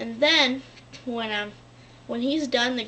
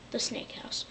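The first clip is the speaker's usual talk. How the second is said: whispered aside; 11 dB quieter than usual. Real, not acted. Aside while explaining Slytherin House.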